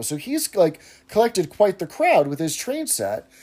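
usual speaking voice

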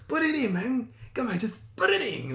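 high, insistent mock-accented coaxing; convincing coaxer. Date pushing her to hand over card, hammed-up coaxing. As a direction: wheedle in an exaggerated high voice. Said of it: "Very convincing".

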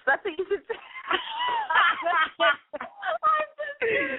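laughing through the words; cracking up mid-line. Real, not acted. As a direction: crack up at your own joke. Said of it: "I'm laughing at my own joke".

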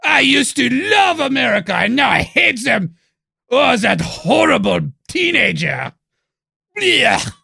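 loud, high mock French outrage; French accent. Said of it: "people in France are gonna be like".

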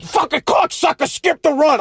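high, angry yell, retold; pissed. At the teammate who skipped the run, an angry retold yell. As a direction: fling the insult at him in mock fury. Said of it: "Oh, I was so pissed".

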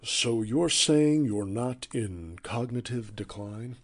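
slow, probing interviewer; measured newsman question; Scott Pelley voice. Retold or imitated. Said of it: "nailed the Scott Pelley".

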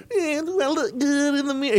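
high, mocking voice; mock vain player. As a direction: tease the player who preens. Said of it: "mocking vain player".

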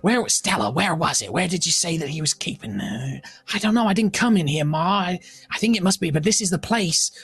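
put-on high, fretful young thief; lightly voiced worried young thief. DM voices thieves searching.

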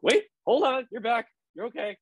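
high, surprised friend voice; imitating Hamish. Friend texting she's back alive, high imitated voice. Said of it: "He's like".

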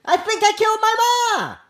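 high, stunned voice for the quote; dazed quote voice. Retold or imitated. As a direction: read the quote like a shaken young man. Quoting a dazed suspect.

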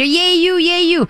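high happy cheer for the dog; cheerleader cheer. Shown as a demonstration. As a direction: cheer like she just won a race. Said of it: "Become the cheerleader".

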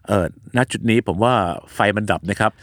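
low, polite repairman. Retold or imitated. Storyteller as repairman addressing an older woman, low-pitched repairman. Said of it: "เขาก็ดู ดู ดู ปรากฏว่า".

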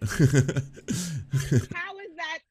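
slow incredulous question; exasperated drawl. Real, not acted. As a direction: stretch it out slowly in disbelief.

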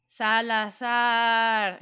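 slow, dreamy drawn-out name; hypnotized. Retold or imitated. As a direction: say it like you're entranced. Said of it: "I was just like ... hypnotized".

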